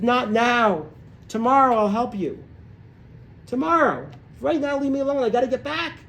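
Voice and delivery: high, urgent runner's plea; acted out of breath. Exhausted runner putting off his wife's question, out of breath. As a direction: puff it out high, brushing someone off. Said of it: "he's out of breath".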